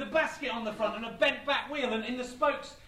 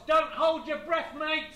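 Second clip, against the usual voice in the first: raised, higher shout to someone far off; shouting across; 6 semitones higher, 7 dB louder, slower than usual. Retold or imitated. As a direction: call it over loudly, mockingly. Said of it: "Lazarus shouted across".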